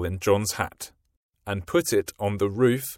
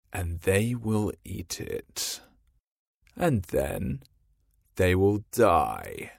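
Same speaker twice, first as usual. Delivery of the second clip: scheming Hook; slower than usual. A narrator's reading. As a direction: savour the evil plan slowly. Hook plotting.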